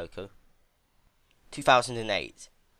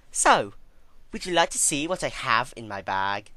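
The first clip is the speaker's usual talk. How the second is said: much higher, louder, sing-song cruel sweetness; mock-sweet villainess; 5 semitones higher, 7 dB louder than usual. Twilight taunting Celestia about her dungeon, sickly sweet and much higher. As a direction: tease her with fake cheer.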